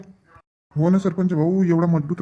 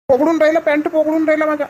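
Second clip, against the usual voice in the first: much higher, frantic stuttered cries; panicked stammering; 8 semitones higher than usual. Acted. Boy slipping in the flood cries out to his friend in panic. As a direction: panic as your foot slips in the current.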